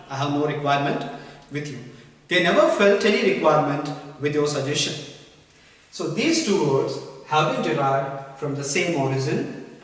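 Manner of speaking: ordinary delivery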